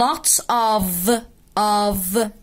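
slow "lots of", the f in "of" voiced as v. Shown as a demonstration. Teacher modelling "lots of" for learners, slow with a held v in "of". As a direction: draw out "of" to end on a buzzing v. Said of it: "بتنطق ف".

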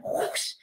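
breathy whooshing sound; whoosh sound effect. Retold or imitated. Describing the arm sweeping past, whoosh noise. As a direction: make the swoosh of an arm. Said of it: "sound of arm passing".

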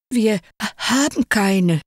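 hoch, verlegen gestottert; zögernd stammeln, ertappt; stotternd. A narrator's reading. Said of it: "stotterte der Kurze". (verlegen stotternd)

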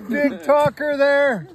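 slow loud teasing jab. Real, not acted. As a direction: drag it out mockingly. Teasing friend's boast.

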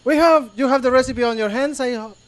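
usual speaking voice

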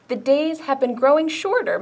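plain reading voice

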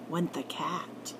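plain reading voice

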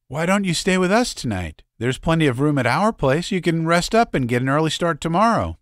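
high, fast, eager invitation; eager young girl. Acted. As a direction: coax them to stay the night. Girl inviting them to stay.

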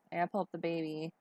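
ordinary talk, nothing marked

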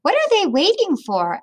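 put-on curious boy asking in the cold; wondering. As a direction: wonder aloud as young Ando. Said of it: "Ando wondered".

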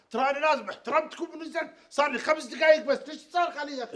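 man shouted at by the attendant protests loudly; angry protest. Acted. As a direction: push back at the man yelling.

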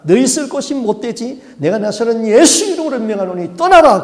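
a preacher thundering at a demon; exorcism command. Retold or imitated. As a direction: cast the demon out at full force. Pastor shouting exorcism command.

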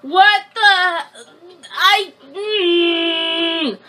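high, drawn-out indignant protest; outraged. Real, not acted. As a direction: sputter in slow, high disbelief. Outraged at burger price.

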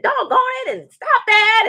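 high mock yell of an annoyed neighbor; imitating his yelling. Retelling neighbor yelling at the TV, high mock yell. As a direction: squeal it like the guy next door losing it. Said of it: "I heard him over there screaming".

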